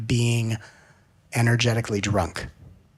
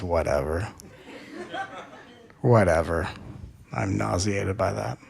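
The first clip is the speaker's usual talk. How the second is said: low, dry, unimpressed whatever with a laugh; sarcastic inner child; 4 semitones lower, 6 dB quieter than usual. Retold or imitated. Inner child rejecting the adult's first I love you, sarcastic and low. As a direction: brush off the love, bored and sarcastic. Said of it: "Let the sarcasm of your inner child".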